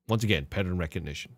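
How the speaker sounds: usual speaking voice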